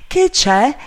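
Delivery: high, loud eager question; excited high call; overjoyed shout. A narrator's reading. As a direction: demand the news, elated. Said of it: "gridò Legrand al colmo della gioia".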